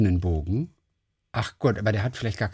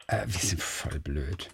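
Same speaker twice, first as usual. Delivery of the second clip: quiet self-mockery; 8 semitones lower, 11 dB quieter than usual. Real, not acted. Agreeing they made a mistake.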